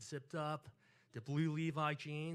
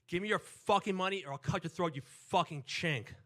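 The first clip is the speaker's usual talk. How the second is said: loud, higher robber's shout; screamed threat; 5 semitones higher, 10 dB louder than usual. Retold or imitated. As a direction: imitate an attacker yelling threats, dragging out the last words. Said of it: "I hear someone scream".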